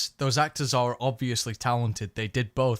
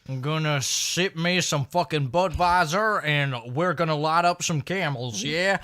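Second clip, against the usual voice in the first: mock Texan accent; 3 semitones higher, slower than usual. Mock Texan version of character.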